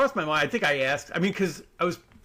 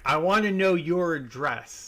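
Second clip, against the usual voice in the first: slow, insistent demand, slightly raised; mock demanding caller; angry caller; 3 semitones higher, slower than usual. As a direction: imitate an irate customer pressing slowly. Acts angry caller demanding address.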